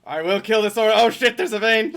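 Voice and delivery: sudden alarmed yell; startled shout. Real, not acted. Sudden enemy in game fight.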